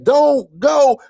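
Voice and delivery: high-pitched desperate plea; imitated screaming. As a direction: cry it out high, mimicking a frantic friend. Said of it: "Then he starts screaming this".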